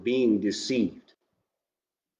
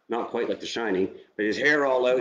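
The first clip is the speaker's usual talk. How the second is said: ordinary delivery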